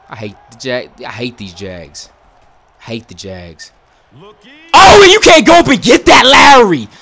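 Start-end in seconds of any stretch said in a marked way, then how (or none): 4.2-6.9 screaming high and loud at the player; furious shout. Real, not acted. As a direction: yell in full outrage at Lowry. Yelling at player missing ball.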